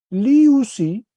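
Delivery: liusi said high and loud; Greek verb form. Shown as a demonstration. Teacher finishing the table for students, loud. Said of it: "Segunda plural".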